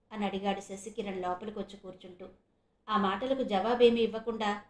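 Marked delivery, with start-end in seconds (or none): none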